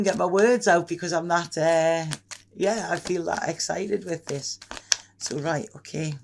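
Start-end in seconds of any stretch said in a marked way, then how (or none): none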